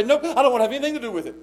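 fast dismissive brush-off; imitating church people refusing; mock refusal. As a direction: rattle it off like a stubborn kid. Mocking stubborn refusal.

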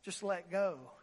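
low, soft small voice; God's small voice answering the dangling man; little voice. Acted. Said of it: "A little voice came and said".

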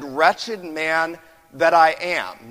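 slow, loud, drawn-out lament; Paul's lament cried out slowly; anguished preaching cry. Acted. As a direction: stretch each word like a cry of despair. Paul's cry voiced in sermon.

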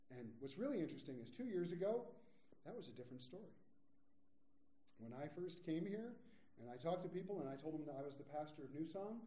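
usual speaking voice

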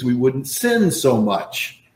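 ordinary talk

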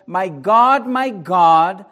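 loud, drawn-out cry to God; preacher re-enacting an anguished cry from the cross. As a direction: cry it out slowly and forcefully. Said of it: "he cries out with a loud voice".

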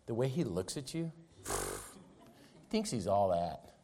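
a hint of the devil sowing suspicion; devil's whispering voice. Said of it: "And he says".

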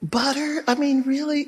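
imitating a shocked health-conscious listener; incredulous skeptic. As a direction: play the doubter gasping at butter. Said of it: "Somebody said".

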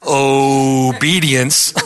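drawn out very slowly, low; slow, low dragged-out taboo word; mock-ominous. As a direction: stretch the forbidden word out long and low. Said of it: "You mention the O word".

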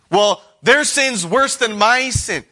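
high, slow, petulant excuse; mock self-justifying whine. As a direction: whine it like a sulky child. Voicing a self-justifying sinner.

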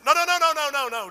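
loud, high burst of no's; loud frantic no, no, no; panicked refusal. Acted. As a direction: stop them at the door, loud and frantic. Homeowner refusing the maid.

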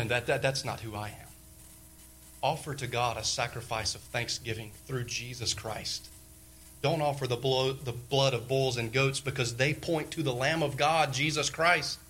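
ordinary talk, nothing marked

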